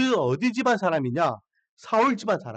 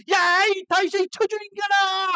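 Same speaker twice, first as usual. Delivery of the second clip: furious shout; 12 semitones higher than usual. Retold or imitated. Shimei shouting curses at fleeing David, furious yell. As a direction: spit the insult after him with full rage. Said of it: "시므이가 뒤에서".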